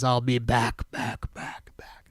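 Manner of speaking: low, dropping into a whisper that echoes away; fading echo. Retold or imitated. Host mimics the spirit's last words as an echoing, fading whisper. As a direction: let each back fade like an echo into a whisper. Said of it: "And that's when he says".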